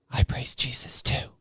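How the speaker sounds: breathy, hushed and unsettling; imitating a creepy substitute's whispered reply; eerie whisper.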